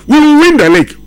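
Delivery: high, loud, confident boast; confident claim. Retold or imitated. Coach Luis Enrique promising the title, imitated confident boast. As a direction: declare it like a coach who is sure of the title. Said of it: "He was so, so confident".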